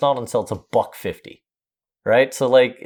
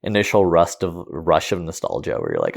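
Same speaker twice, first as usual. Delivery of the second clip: ordinary talk, nothing marked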